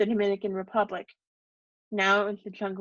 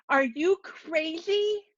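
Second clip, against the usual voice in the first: high, slow outraged cry; shocked shout; 8 semitones higher, much slower than usual. A narrator's reading. Father outraged at wife joining daughter, high slow cry. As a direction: scream the question in disbelief.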